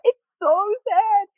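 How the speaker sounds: high, slow mock wail; imitated crying. Crying actress answers her flatmate, mock wailing. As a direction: draw it out in comic weepiness. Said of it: "you're still crying like this".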